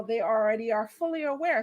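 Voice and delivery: normal talking voice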